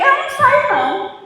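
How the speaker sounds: high, loud and stubborn, refusing to budge; defiant refusal. Retold or imitated. To the spirit ordering her out, a defiant loud refusal. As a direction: plant your feet and refuse.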